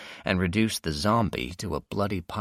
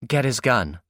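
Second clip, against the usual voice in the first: sharp order; 6 semitones higher, 5 dB louder than usual. Acted. Villainess orders henchman.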